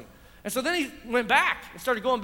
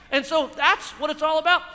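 ordinary talk

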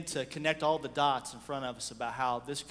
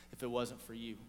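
softer and lower, heartfelt; tender thanks; 4 semitones lower, 7 dB quieter than usual. Retold or imitated. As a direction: hold them close and thank them. He hugs each member.

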